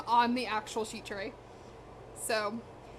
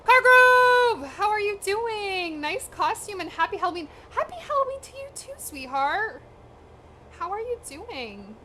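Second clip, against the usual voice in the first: bright, high, loud hello to a viewer; excited high greeting; 7 semitones higher, 7 dB louder than usual. Real, not acted. Streamer greets a viewer named in chat, excited high hello. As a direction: call out to a fan with delight.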